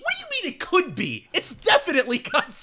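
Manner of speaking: high-pitched mock indignation; incredulous outrage. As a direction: fire back in hammed-up disbelief. Outraged retort to retold movie dialogue.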